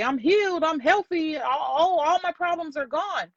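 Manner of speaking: high, lilting make-believe rejoicing; imagined quote, pitched well up; mock elation. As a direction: play someone delighted by an overnight miracle. Acting out imagined overnight miracle.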